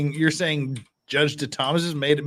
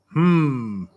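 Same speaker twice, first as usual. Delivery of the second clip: ordinary delivery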